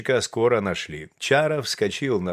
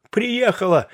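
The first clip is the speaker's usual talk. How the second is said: high, eager exclamation; she's back, cried out high; excited cry; 12 semitones higher, 4 dB louder, slower than usual. A narrator's reading. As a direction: burst out with relief. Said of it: "вскричал Чаров".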